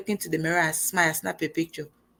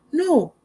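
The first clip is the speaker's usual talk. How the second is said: "No." high and firm, much higher; emphatic no; 10 semitones higher, 4 dB louder than usual. Real, not acted. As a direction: shut the doubt down. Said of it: "emphatic denial".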